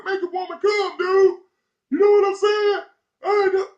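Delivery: high, loud mocking heckler voice; heckler taunt. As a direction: jeer at the star across the street.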